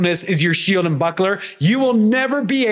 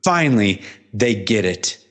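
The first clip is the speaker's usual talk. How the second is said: a gentle, much lower Jesus finally understood; Jesus speaking; 9 semitones lower than usual. Retold or imitated. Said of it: "and he goes".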